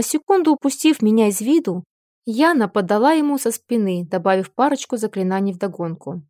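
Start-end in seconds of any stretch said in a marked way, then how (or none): none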